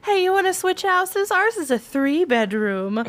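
high fake friendly salesperson; mock sweet voice. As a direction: lure them in with fake sweetness. Mocking the neighbor's offer.

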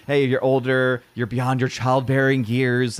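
ordinary talk, nothing marked

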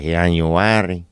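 put-on drawn-out Spanish-accented month name.

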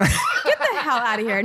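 laughing, high-pitched get outta here; cracking up in disbelief. Real, not acted. Disbelief at fake band name.

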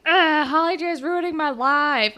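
mock Allie's self-pity; whiny teen mimic. Allie complaining about Holly J, whiny mock voice.

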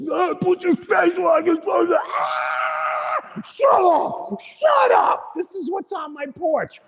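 high, loud frantic shouting; mock armed robbers shrieking, high and loud; robbers yelling. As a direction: scream threats like panicked armed robbers.

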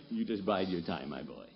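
ordinary delivery

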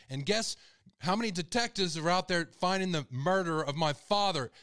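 real hurt boiling over into a loud telling-off; emotional outburst. Real, not acted. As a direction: fire back loudly, wounded and angry. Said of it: "Sorry, I get emotional".

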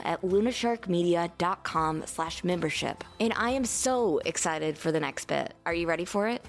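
soft, upbeat podcast ad read. Acted. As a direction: read it like a friendly sponsor spot. Sponsor ad read.

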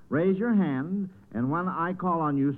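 usual speaking voice

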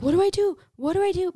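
high, frantic retold panic; panicked cry. Driver asking her friends in a panic, high frantic cry. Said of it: "I'm like".

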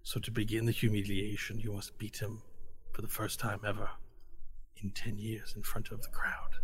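conspiratorial whisper. Acted. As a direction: whisper it like a secret plan. Scheming mentor lowers voice.

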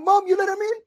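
can't believe she let them in; shocked disbelief. Retold or imitated. Shocked question to mother.

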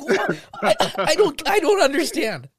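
high, loud bewildered stammer; a child who can't make sense of it; confused kid. Retold or imitated.

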